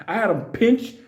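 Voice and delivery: ordinary talk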